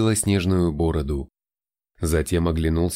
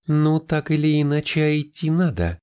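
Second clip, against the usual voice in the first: put-on raised young woman's voice, higher; resigned but brisk heroine; голос Тайры; 8 semitones higher than usual. A narrator's reading. Тайра voiced, repeated words. (выше, голосом героини)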